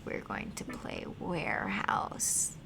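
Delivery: normal talking voice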